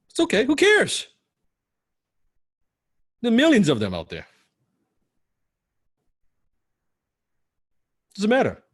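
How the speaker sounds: high, loud, brushing it off; emphatic. Real, not acted. Coach to students, loudly brushing off rejection. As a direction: wave away the worry with a loud high cry.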